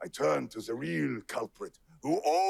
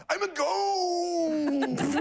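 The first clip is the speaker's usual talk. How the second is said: spooky ghost voice; 13 semitones higher, 3 dB louder, much slower than usual. Acted. Dramatic story ending.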